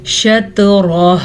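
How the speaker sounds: शतरह with heavy रॉ and stopped हा; slow recitation. Shown as a demonstration. Teacher repeating a word for students. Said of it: "फिर से सुने".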